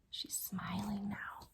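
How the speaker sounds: soft whispered remark. Real, not acted. Reader pointing at the smiling princess, whisper. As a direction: breathe it out quietly.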